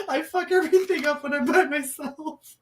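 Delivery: high, giggly self-mockery; laughing. Real, not acted. Co-host admitting she messes up alone, laughing. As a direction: squeeze the words out through laughter. Laughing at her own mishaps.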